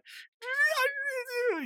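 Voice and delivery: very high-pitched mock giggle; absurdly high, odd little titter; weird little giggle. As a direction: imitate a cartoon character's squealy silly giggle. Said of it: "he makes the, the weirdest little giggle".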